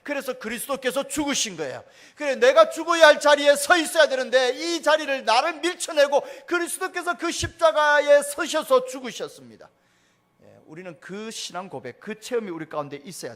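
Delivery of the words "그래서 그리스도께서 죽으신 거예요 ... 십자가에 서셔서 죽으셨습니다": loud, high, impassioned sermon delivery; full-force preaching. Acted. Pastor preaching on the cross, loud and high. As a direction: proclaim it to the whole congregation.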